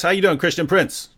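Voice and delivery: normal talking voice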